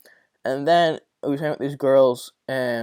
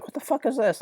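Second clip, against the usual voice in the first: a high, stunned what-the-hell; shocked outburst; 12 semitones higher than usual. Retold or imitated. Walking back into his moved-around room, shocked high outburst. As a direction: pitch jumps way up in disbelief. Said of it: "I'm like".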